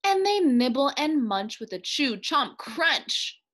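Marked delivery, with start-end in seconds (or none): none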